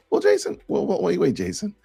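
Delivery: high, squeaky, alarmed protest; mock objector. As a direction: parody a flustered critic clutching pearls. Imitating shocked objectors.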